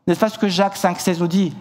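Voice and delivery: ordinary talk, nothing marked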